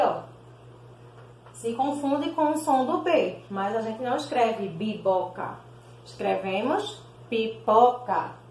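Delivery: usual speaking voice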